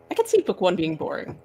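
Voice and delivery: ordinary talk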